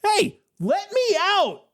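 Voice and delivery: high, slow angry cry; acted outrage, stretched and very high; mad yell. A narrator's reading. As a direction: yell to the guards in high-pitched anger. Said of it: "Now you're mad".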